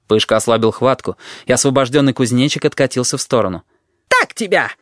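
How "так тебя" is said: high-pitched triumphant cry; high battle cry; shouting. A narrator's reading. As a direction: shout it as you jab your enemy. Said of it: "крикнул Вонючка".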